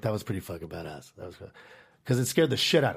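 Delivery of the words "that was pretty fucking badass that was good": low impressed buddies. Retold or imitated.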